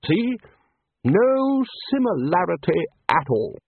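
high, slow, insistent triumph; emphatic proof. A narrator's reading. Ridcully contrasting bathroom and beach, high and insistent. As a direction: swoop up high and stretch it out. Ridcully proves his point.

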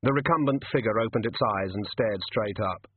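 usual speaking voice

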